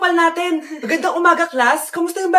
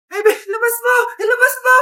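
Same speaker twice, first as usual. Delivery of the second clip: higher panicked yelling; frantic shout; 6 semitones higher, 4 dB louder than usual. Acted. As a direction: yell at the baby in panic. Yelling at choking baby.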